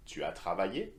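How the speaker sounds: statement turned question by a rise on "travaillé"; rising question intonation. Shown as a demonstration. Teacher modelling a French question for learners, rising intonation. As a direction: lift "travaillé" sharply at the end. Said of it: "the intonation up".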